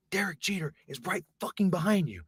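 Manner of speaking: tense, emphatic stage whisper; hushed but insistent; urgent whisper. Retold or imitated. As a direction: whisper a warning to a clueless friend. Warning friend under breath.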